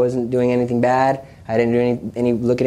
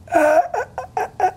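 choked, high strangled stutter; broken, squeezed attempts at a word; unable to speak. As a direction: act out straining to talk while being choked. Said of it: "it was that I couldn't speak".

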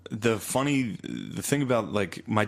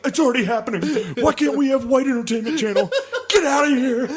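very high, louder laughing caricature; mock bigot; 6 dB louder than usual. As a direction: whine it as a cartoon bigot. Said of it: "It's like".

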